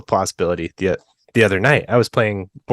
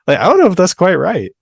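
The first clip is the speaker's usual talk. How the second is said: doubtful; 9 semitones higher, 6 dB louder than usual. Retold or imitated. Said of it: "you're just like".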